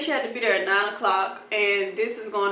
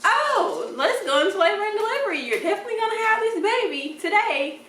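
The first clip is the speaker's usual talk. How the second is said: high, bubbly nurse voice, pitched way up; giddy singsong announcement; 10 semitones higher than usual. Retold or imitated. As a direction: sell it like great news, bursting with glee. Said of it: "she gave me the giddy".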